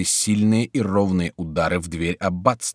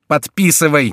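loud, high impatient order; impatient cry; 7 semitones higher, 8 dB louder than usual. A narrator's reading. Mayenne and duchess pressing the king to sign, impatient cry. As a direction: urge it out with impatience. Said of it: "нетерпеливо вскричали Майен и герцогиня".

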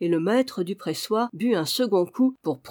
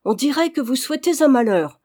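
high, loud, tart reproach; sour outcry; 6 semitones higher, 6 dB louder, faster than usual. A narrator's reading. Chambermaid accusing old Goton, crying out sourly. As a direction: cry out in bitter accusation. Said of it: "s'écria aigrement Renée".

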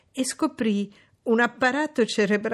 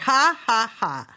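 mock laugh; 4 semitones higher, 4 dB louder, slower than usual.

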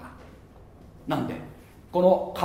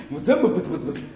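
laughing mutter; much faster than usual. Retold or imitated. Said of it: "ブツブツ言うな".

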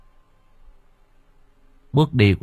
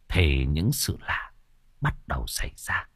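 ominous hush; 6 semitones lower, 8 dB quieter than usual. A narrator's reading. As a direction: let it sink into dread. Ominous turn in ghost story.

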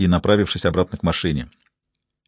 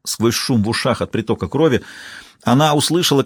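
ordinary delivery

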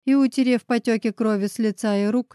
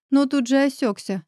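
ordinary delivery

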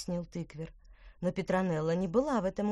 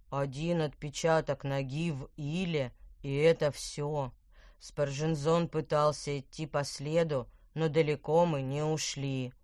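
deep beetle voice; 3 semitones lower than usual. Acted. Voices the stag beetle Lucius.

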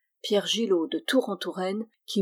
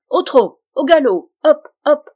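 high, very loud riding commands; goblin's shout; 7 semitones higher, 12 dB louder than usual. A narrator's reading. Goblin riding the friar like a horse, shouting. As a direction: drive your mount into a gallop. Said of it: "cria le lutin".

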